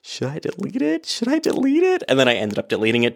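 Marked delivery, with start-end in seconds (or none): 0.0-1.1 fretting. Retold or imitated. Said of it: "I was like". 1.1-2.0 squeaky, much higher fretting question; hammed-up anxious squeak; panicked squeak. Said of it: "I was like".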